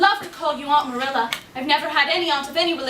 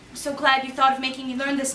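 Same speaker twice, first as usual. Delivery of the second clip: ordinary delivery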